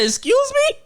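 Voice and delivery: very high incredulous question; retold disbelief. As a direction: squeak it out in comic disbelief.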